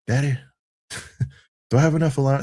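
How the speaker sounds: a young son asking Daddy, in a put-on country accent; light country twang for the kid's question. Dad imitating his little son asking about allowance, country accent. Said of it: "he doesn't have a country accent".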